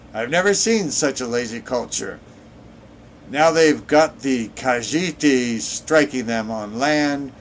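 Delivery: half-hearted attempt at a Nord accent; bad Nord accent. A narrator's reading. Nord denouncing a lazy culture, in a bad Nord accent. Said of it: "trying to do a Nord accent".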